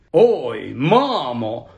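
swooping, drawn-out exclamation; feigned surprise. A narrator's reading. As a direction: act as if just noticing mum. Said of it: "вигукнув Фред, ніби щойно її побачив".

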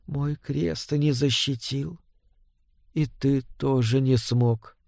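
very soft, slow, drained of strength; weak, faint voice. A narrator's reading. Ailing wife telling her husband he failed her, faint and slow. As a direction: speak feebly, with long gaps between phrases. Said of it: "Голос был едва слышен".

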